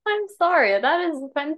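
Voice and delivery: high, loud protest; indignant. Real, not acted. Co-host reacts to village idiot insult, indignant. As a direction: take offence out loud, pitched right up.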